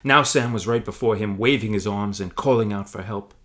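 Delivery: ordinary delivery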